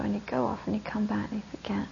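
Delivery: hushed narration. Acted. Storyteller drops to a hush.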